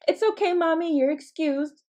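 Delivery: put-on high-pitched, forgiving kid voice; innocent child. Child missing mom's sarcasm, high innocent forgiveness. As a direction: grant pardon in a high little voice.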